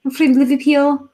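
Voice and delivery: put-on loud, high Scouse accent; exaggerated Liverpudlian accent; Liverpool accent. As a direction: proclaim it like a Scouser. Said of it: "You get Liverpool".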